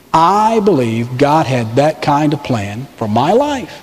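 ordinary talk

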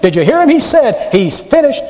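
sing-song taunt from a jeering demon; gloating demons. Retold or imitated. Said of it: "picture the demons rejoicing, saying".

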